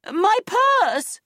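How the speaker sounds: high, loud shriek of dismay; panicked shouting. A narrator's reading. Woman looking in her bag, shouting high and loud in dismay. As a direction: shriek it out in alarm. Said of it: "she shouted".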